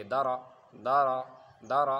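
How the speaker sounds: دارا in two short, loud syllables "da-ra"; firm, short model of دارا; unstretched vowels. Shown as a demonstration. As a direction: say دارا briskly without drawing out either vowel. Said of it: "کھینچنا نہیں".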